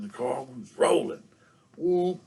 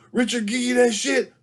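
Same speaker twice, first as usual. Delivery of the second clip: much higher, louder demanding auntie; 12 semitones higher, 9 dB louder than usual. Retold or imitated. Auntie interrogating nephew about Richard, loud and high. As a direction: snap the question at the boy. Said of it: "She's like".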